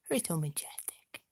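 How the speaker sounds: breathless whisper to the cat; awed whisper. Real, not acted. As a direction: gasp then whispered praise.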